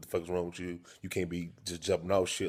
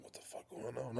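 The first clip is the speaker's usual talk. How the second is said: hushed; 2 semitones higher, 17 dB quieter than usual. Retold or imitated. Scared retold quote.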